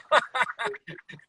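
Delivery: high laugh. Real, not acted. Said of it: "laughs after quote".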